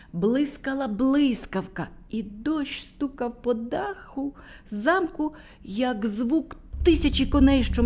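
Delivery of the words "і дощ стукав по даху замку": plain reading voice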